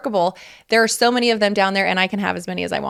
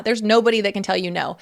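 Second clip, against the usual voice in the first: ordinary talk, nothing marked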